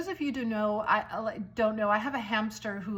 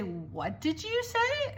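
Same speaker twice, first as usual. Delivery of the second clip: puzzled mom; 6 semitones higher, much slower than usual. Retold or imitated. Moms puzzled at playground.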